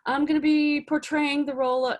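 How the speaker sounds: high, slow, overly bubbly self-introduction; mock a too-cheery actor greeting the camera; hammed-up perky slate intro; chirpy actor slate. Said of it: "You don't have to be like".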